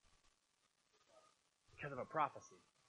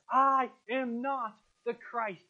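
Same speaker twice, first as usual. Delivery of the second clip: "I am not the Christ" slow and louder, leaning on "I"; double emphasis; 3 semitones higher, 7 dB louder, much slower than usual. Retold or imitated. Preacher quoting an anxious, reiterated confession, double emphasis. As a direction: stretch "I am not" with heavy, emphatic force. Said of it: "double emphasis".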